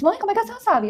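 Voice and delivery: wide-eyed wonder that she knew; amazed question. Retold or imitated. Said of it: "Eu disse".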